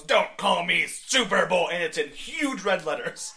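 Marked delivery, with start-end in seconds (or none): 0.0-1.7 slow, much louder over-the-shoulder yell; Superboy yell. Retold or imitated. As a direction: snap it back over your shoulder. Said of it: "over one shoulder goes".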